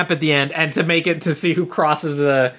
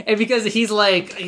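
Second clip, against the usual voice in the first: usual speaking voice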